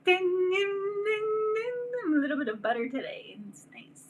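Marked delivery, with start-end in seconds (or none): 0.1-2.2 high sung dings; bell imitation. Mimics a bell. 3.5-4.0 whisper. Acted. Butter character aside.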